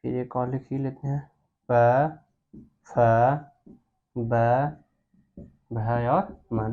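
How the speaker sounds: low, soft letters with long pauses; reciting while writing. Real, not acted. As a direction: murmur each letter separately as you write. (धीमे स्वर में एक-एक अक्षर)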